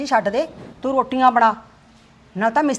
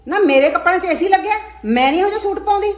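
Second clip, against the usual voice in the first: loud, sharp scolding; angry scolding; 2 semitones higher, 5 dB louder than usual. Acted. Mother-in-law scolding daughter-in-law over her clothes, loud and angry. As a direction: tear into her about the clothes.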